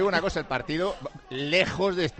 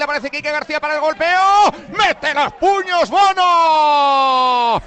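live play-by-play; 8 semitones higher, 5 dB louder than usual. Real, not acted. Commentator follows an attack until keeper punches, building play-by-play. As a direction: rattle through the passes, then belt out the save.

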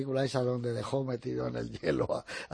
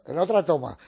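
loud, raised-pitch retold apology; raised-voice mock apology; acted apology; 4 semitones higher, 8 dB louder than usual. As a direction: act out apologising on set, loudly. Actor apologising in retold film shoot.

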